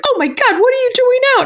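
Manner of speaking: high, shrill disbelief from the neighbours; mock shocked. Retelling neighbours gasping at her driving in a blizzard, mock shocked. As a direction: squeal it like a stunned neighbour.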